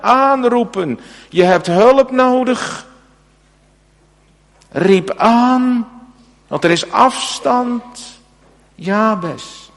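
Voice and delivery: preaching at full force, high and loud; fervent preaching. Acted. Preacher pressing the congregation about crying out to God, fervent full-force preaching. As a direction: drive the sermon home with rising urgency.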